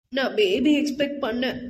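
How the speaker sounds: small, tearful confession; pitiful. A narrator's reading. Wife admitting she had expected a baby, pitifully. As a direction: confess it pitifully, close to tears. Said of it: "என்றாள் பரிதாபமாக".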